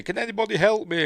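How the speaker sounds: high, loud, hurried cry for help; mock plea for help. Heroine pleads into the radio, high mock distress. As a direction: imitate a heroine calling for rescue.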